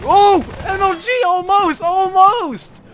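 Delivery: very high, loud thrilled squeal; excited shriek. Real, not acted. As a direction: squeal over a near miss. Near escape in game.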